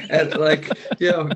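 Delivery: ordinary talk, nothing marked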